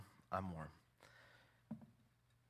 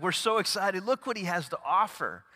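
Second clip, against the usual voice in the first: higher and louder, giddy followers; excited crowd; 8 semitones higher, 9 dB louder than usual. Retold or imitated. Pastor voicing the crowd chasing Jesus, excited. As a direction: gush like a thrilled crowd.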